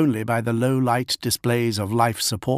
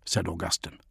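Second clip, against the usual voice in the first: ordinary delivery, nothing marked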